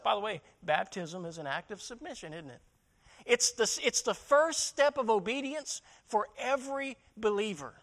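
ordinary talk

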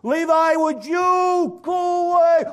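high, drawn-out yell; exasperated full shout; frustrated holler. Acted. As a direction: bellow it slowly at the pest outside. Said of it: "[name] is getting frustrated".

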